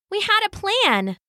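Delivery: put-on high child's voice; little girl Sasha. A narrator's reading. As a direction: protest as a small girl. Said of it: "said Sasha".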